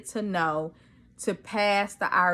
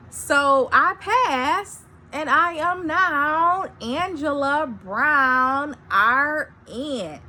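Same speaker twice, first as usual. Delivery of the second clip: proud, drawn-out declaration of the new title; proud announcement; 7 semitones higher, slower than usual. Shown as a demonstration. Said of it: "Let me say it again".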